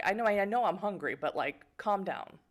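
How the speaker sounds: ordinary talk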